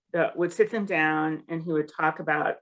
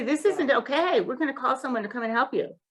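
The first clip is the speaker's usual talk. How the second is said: higher-pitched firm intervention; stepping in; 6 semitones higher, faster than usual. Pretend. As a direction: step in, pitched up, quicker at the end. Voices a bystander stepping in.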